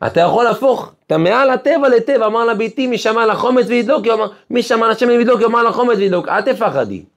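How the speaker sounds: fiery preaching. Acted. Rabbi preaching to the congregation, fervent. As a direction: preach it out with full fervor.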